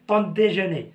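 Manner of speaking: ordinary talk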